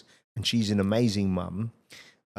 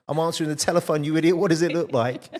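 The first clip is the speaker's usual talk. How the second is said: louder, higher, mock-indignant comeback; cheeky retort; 8 semitones higher, 9 dB louder than usual. Young cleaner firing back at the manager, loud and cheeky. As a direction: throw it back at him, playfully outraged.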